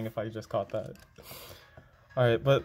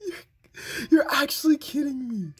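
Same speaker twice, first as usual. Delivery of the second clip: anguished disbelief; 5 dB louder than usual. Real, not acted. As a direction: wail it as if about to cry. Disbelief at game.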